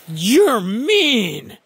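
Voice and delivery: high, slow mock outrage; drawn-out playful scolding; playful protest. Real, not acted. Friend says pour salt on snail.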